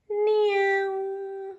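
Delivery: high little mewing cat; cat meow. Acted. Toy cat sounding off in the clubhouse, high mew.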